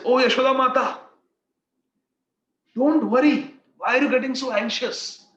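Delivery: Madhumangal. Retold or imitated. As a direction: cheer up the worried mother, loud and high. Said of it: "he speaks".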